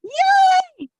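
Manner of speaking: high, loud happy yay; excited cheer. Real, not acted. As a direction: cheer as a friend arrives. Greeting a friend joining.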